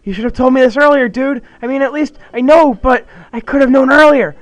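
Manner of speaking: high, loud panicked ranting; freaking out. Acted. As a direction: rant in rising panic at his friend. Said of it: "he's majorly freaking out".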